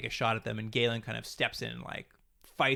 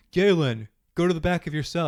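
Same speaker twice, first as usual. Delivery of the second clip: raised voice, calling through a door; retold yell; 5 semitones higher, 7 dB louder than usual. Rescuers calling to Galen through the locked door, imitated yell. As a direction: call it out loudly, imitating the rescuers. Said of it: "That's what they yell at him".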